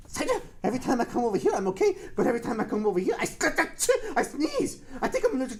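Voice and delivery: fast, excited realisation; excited discovery. Real, not acted. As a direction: blurt it out, suddenly getting it. Excited retake after gasp.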